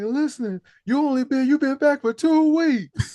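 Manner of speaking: high, slow mock-scolding mama; imitating the mother. Retelling the mother scene, high mock-scolding mama. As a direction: nag him in a high pleading voice. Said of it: "It's like".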